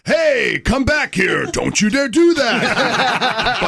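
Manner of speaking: hammed-up video game yell in the sound booth; over-the-top shout. Shown as a demonstration. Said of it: "And it's like".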